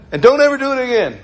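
high, loud, quick warning; mad scolding. Retold or imitated. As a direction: scold them fast and high. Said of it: "they came up to me mad".

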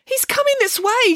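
very high-pitched frightened squeal; panicked squeal. A narrator's reading. Samantha sees the man coming, panicked squeal. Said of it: "squealed Samantha, in panic".

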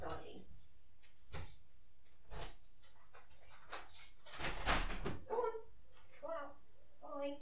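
high, soft voice for the cat; talking to a pet. Real, not acted. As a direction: coax the cat outside gently.

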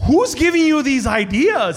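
hammed-up indignant question, slow and loud; mock exasperation. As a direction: play up how absurd the idea sounds. Exasperated reply to nephew's car assumption.

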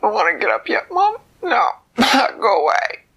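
put-on a girl refusing to get up for mom; high giggly grumble; sleepy Goldilocks. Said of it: "Goldilocks went".

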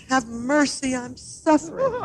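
high, pitiful pleading; wailing. A narrator's reading. Suffering fox begging the dentist for mercy, wailing. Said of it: "the fox wailed".

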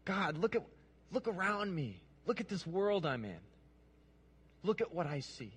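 quietly acted cry of grief; Habakkuk's lament. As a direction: plead upward in a hushed, hurting voice. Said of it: "it kinda looks like this".

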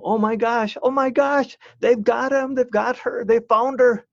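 a thrilled parent shrieking with relief; excited parent on phone. Retold or imitated. A parent on the phone hearing their child was found, high and overjoyed. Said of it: "you'd hear the person on the other line go".

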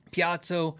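Piazzo with a "ts" in the middle; Piazzo as pee-AHT-so. Shown as a demonstration. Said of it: "people fight about it".

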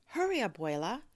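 high, loud plea; urgent urging. Acted. Girl rushing grandmother through traffic, high urgent plea. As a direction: push her to hurry.

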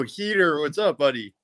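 ordinary delivery

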